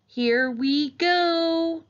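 high, loud, very drawn-out send-off; long soaring cry, held high on the last word; excited launch call. A narrator's reading. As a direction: stretch it out as you plunge downhill. Child launches down steep cliff.

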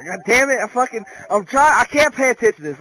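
loud, fast, exasperated; angry rant at the song; frustrated outburst. Real, not acted. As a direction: vent loudly at the lyrics.

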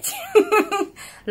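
loud, high amused laugh; high burst of laughter. Real, not acted. Vlogger laughing at talking to her cat, loud high laugh. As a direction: break into a real laugh at your own joke.

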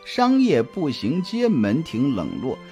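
usual speaking voice

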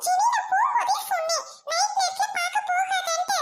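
high-pitched reproach; scolding. Acted. Friend reminding her of the warning, scolding.

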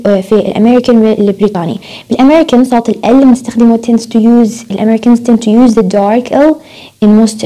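usual speaking voice

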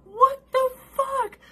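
shrieked very high and slow; disbelieving high shriek; outraged squeal at the dawdlers; exasperated what the fuck. Retold or imitated. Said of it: "I'm like".

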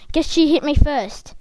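normal talking voice

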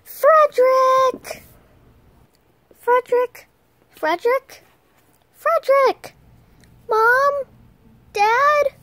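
very high, loud hollering; increasingly questioning make-believe calls; pretend searching call. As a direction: shout through the house for missing family. Searching, nobody answers.

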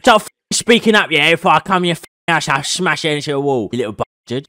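hammed-up British tough talk, loud in the middle. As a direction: put on a mock thuggish threat.

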